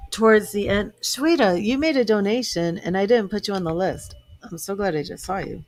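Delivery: ordinary delivery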